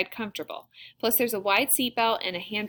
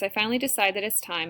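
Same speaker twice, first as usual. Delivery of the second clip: ordinary delivery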